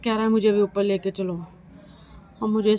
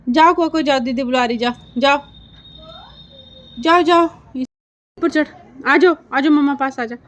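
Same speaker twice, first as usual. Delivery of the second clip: high, loud encouraging calls to a pet; coaxing the dog; 6 semitones higher, 9 dB louder than usual. Real, not acted. Owner urges her scared dog to climb up, loud high coaxing. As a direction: urge the nervous dog up the stairs, loud and high.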